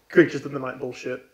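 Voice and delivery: ordinary talk, nothing marked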